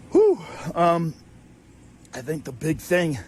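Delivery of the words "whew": cold shaky exhale. Real, not acted.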